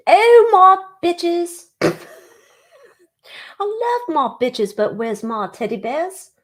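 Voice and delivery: high, drawn-out mock croon; playful put-on voice. Greeting the viewers as her bitches and teddy bears, playful high put-on voice. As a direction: stretch it out in a silly high voice.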